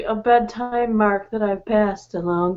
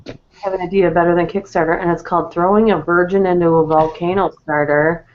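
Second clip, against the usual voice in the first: rushed, lower pitched pitch line; fast read; 6 semitones lower, 3 dB louder, faster than usual. Pretend. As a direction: rattle the card off fast. Rereading the card quickly.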